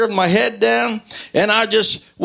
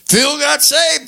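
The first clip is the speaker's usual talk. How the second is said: high, drawn-out mock disbelief; incredulous echo; 6 semitones higher, slower than usual. Imitating family who got the phone call, incredulous. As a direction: stretch it out high, as if you can't believe it. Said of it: "They said, "No way".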